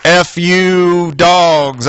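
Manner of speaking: very slow, dragged-out mock cursing of the team; Saturday night's disgusted reply to the Friday cheer; bitter curse. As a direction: spit it out slowly after the loss. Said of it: "I was saying Saturday night".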